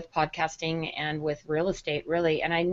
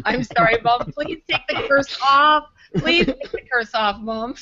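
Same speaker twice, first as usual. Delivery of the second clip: pleading; 4 semitones higher, 4 dB louder than usual. Retold or imitated. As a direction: beg her to lift the curse. Pleading with mom to stop.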